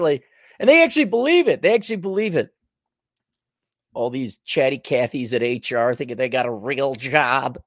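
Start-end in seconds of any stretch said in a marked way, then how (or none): none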